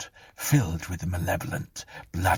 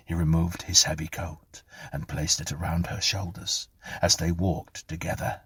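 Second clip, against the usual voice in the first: ordinary delivery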